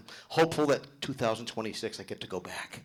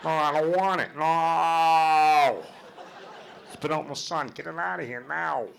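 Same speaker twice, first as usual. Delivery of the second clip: a rejecting, raised-voice rant, higher and louder; Ray Romano; 4 semitones higher, 4 dB louder than usual. Retold or imitated. Ray Romano yelling at the caterer, angry and higher. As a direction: shove the potato salad away in disgust. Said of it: "Ray loses his shit".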